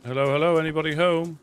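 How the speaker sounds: loud, high call into the house; calling down a hallway. Acted. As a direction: call out, hoping someone answers.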